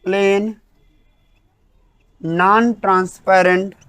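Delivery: very slow, separated words for note-taking; word-by-word dictation. Acted. Teacher dictating the English definition, very slow and spaced. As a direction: dictate each word with long gaps.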